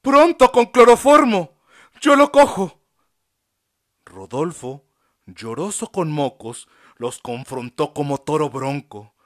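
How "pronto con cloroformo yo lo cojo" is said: high, loud urgent summons; urgent call. A narrator's reading. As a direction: call the doctor in a hurry. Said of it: "convocó Sor Flor".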